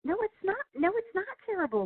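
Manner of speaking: high-pitched mock protest; playful outraged no. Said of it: "I'm like".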